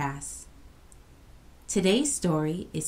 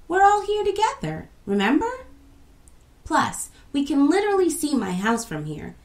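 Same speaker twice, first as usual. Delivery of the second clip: higher, lightly voiced cheerful child; reassuring friend; 8 semitones higher, 3 dB louder than usual. A narrator's reading. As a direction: soothe a nervous friend, brightly. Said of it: "said Quasi".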